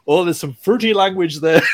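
a light, giggly Scottish commentator impression; Scottish accent. Commentator Jamie Lyle quoted in a laughing mock Scottish accent. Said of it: "in that great thick Scottish accent of his".

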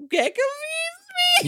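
high, slow giggly complaint; laughing. Real, not acted. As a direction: drag it out giggling.

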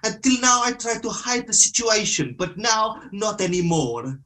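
put-on high-pitched Italian-accented rant; put-on Italian accent, pitched well up; manager's Italian accent. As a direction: read it as an aggrieved Italian manager. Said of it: "I can do it in his accent".

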